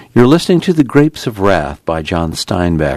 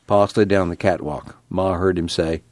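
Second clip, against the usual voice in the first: ordinary delivery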